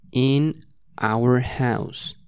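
in our house drawn out slowly; slow model phrase. Shown as a demonstration. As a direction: stretch it out for students. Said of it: "Repeat after me".

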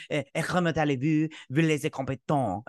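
hammed-up French accent, mocking the sound. Mocking how French people sound, in a fake French accent. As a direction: parody a French speaker's French accent. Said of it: "They're like".

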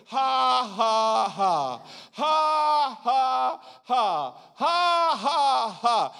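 high, stretched spoken "ha"s, falling in threes; deliberate forced laugh. Retold or imitated. Preacher as Hagin answering the devil, high forced laugh. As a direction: imitate a defiant laugh without mirth. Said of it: "Didn't feel it".

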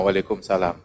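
low, soft, cold answer; dismissive murmur; cold reply. A narrator's reading. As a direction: brush him off as a stranger. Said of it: "jawabannya sama".

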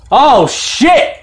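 high, alarmed loud burst, retold; retold out-loud cry. Dad retelling his reaction to the head wound, out-loud cry. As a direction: blurt it loud and high, imitating your panic. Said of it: "out loud, out loud, I went".

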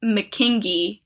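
slow, chanted rhyme in a clumsy Scottish accent; halting Scottish-spelling singsong, deliberately drawn out; bad Scottish accent. Shown as a demonstration. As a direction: read the rhyme slowly in a badly faked Scottish accent. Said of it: "I can't do a Scottish accent".

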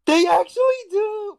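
high shouted disbelief; excited shout. Real, not acted. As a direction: yell it in shock. Stunned, disbelieving outburst.

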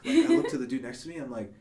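ordinary talk

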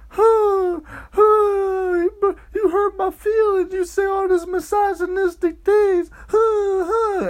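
very high whimpering fake sobs; mock crying. Women whining about hurt feelings, hammed-up crying. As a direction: wail it in a squeaky, tearful voice. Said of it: "everybody starts crying".

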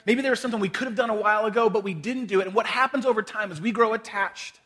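usual speaking voice

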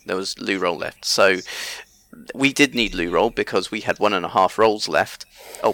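normal talking voice

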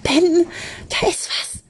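urgent, high hissed whisper; hissing. A narrator's reading. Charlotte warns Ben something is there, hissing. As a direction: hiss a frightened warning. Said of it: "zischte Charlotte".

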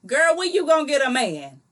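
loud, high-pitched prying relative; loud high needling question; nosy aunt. Acted. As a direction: badger her like an auntie at a holiday party. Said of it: "your aunt said".

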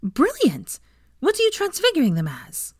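high-pitched eager delight. A narrator's reading. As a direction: exclaim and ask with high excitement. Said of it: "Ginny's smile was wide".

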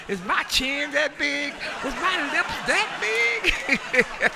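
high, slow, appalled questions; high-pitched mock horror, laughing; horrified self-check. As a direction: gasp at your own reflection. Shocked person seeing own face.

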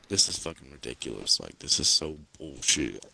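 low-pitched retold complaint; low grumble. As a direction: grumble to yourself, passive-aggressively. Retold verbal grumbling when mad.

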